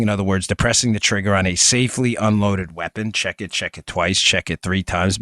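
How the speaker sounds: steady host-read sponsor pitch; podcast host reading his sponsor spot. Acted. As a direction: sell the product to listeners, easy and even. Sponsor ad read for target system.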